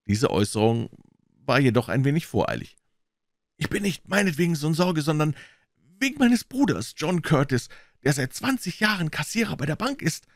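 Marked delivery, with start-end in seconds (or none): 3.6-10.3 raised pitch, mildly agitated; anxious visitor, a touch worked up. A narrator's reading. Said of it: "erwiderte der Mann aufgeregt".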